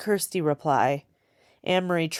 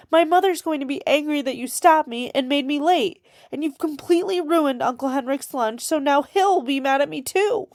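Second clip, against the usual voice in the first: much higher, tearful pleading; crying; 10 semitones higher than usual. A narrator's reading. Girl blaming the soldier through tears, crying. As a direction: blurt it out on the edge of tears. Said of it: "she began to cry".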